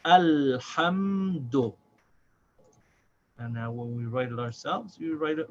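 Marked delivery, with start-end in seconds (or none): 0.0-1.7 very slow "Alhamdu", syllable by syllable; slow careful model. Shown as a demonstration. Teacher slowly modelling "Alhamdu" for the student. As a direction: stretch out "Alhamdu" for the learner. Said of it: "the dal at the end".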